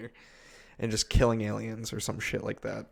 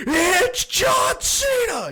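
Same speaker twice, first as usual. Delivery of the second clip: high, loud, drawn-out holler; announcer-style meme shout; 7 dB louder, much slower than usual. Acted. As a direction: blast it out like a surprise meme sound. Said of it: "all you hear".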